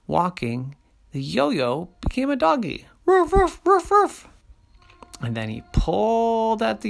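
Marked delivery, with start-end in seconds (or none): 3.1-4.3 high yappy ruffs, louder; puppy barking. Acted. Storyteller barks as the yo-yo turns into a puppy. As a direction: bark like a little dog. Yo-yo became a doggy.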